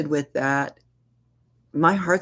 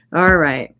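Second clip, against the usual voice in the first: laughing; 2 semitones higher, 8 dB louder than usual. Real, not acted. Presenter laughing as she moves on to scenario three. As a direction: laugh then announce.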